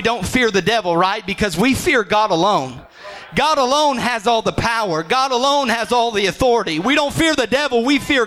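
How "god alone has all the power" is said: ordinary delivery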